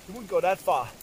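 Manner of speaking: ordinary delivery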